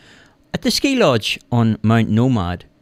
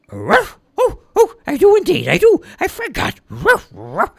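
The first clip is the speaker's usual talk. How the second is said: very high, loud sudden realisation; dog surprised; 5 dB louder than usual. A narrator's reading. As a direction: gasp and realise you forgot.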